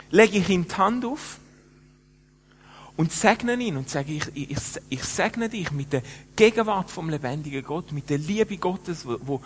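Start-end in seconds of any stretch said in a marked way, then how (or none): 4.1-9.4 blessing. Retold or imitated. Pastor blessing the clubber.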